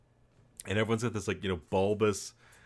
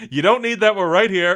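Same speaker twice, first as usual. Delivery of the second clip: loud film quote; 8 semitones higher, 9 dB louder than usual. Retold or imitated. Reciting a film line.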